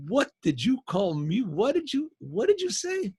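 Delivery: incredulous. Retold or imitated. Man reacting in disbelief.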